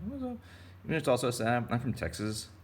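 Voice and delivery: a squeaky little greeting; cowboy fish greeting. Pretend.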